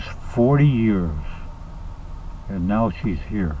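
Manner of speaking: slow, low, sinking to a murmur; weary brooding. Acted. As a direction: mull it over slowly, dropping away. Husband broods on wife's arrival.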